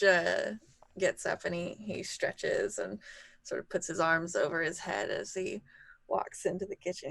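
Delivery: usual speaking voice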